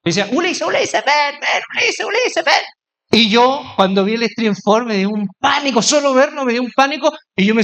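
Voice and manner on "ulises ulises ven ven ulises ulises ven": high, insistent imitation of calling youngsters; mock calling out. Youngsters summoning their pastor, high repeated mock shouts. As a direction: pester by name in a high put-on voice, no real volume. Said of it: "comienzan a gritar".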